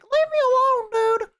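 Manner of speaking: very high, squeaky whine; mock squealing child voice; ten-year-old kid. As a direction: plead like a picked-on little kid. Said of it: "like, a ten-year-old that was like".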